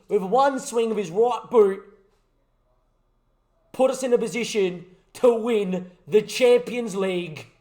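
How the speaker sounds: loud, lively play-by-play call; excited match commentator building to the goal; live commentary. Acted.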